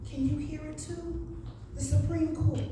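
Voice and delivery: usual speaking voice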